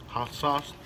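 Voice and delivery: normal talking voice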